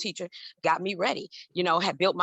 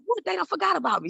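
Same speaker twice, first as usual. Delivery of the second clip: high, louder what, forgot me; outraged at being left waiting; indignant disbelief; 10 semitones higher, 4 dB louder than usual. Retold or imitated. Left waiting for hours, high indignant complaint.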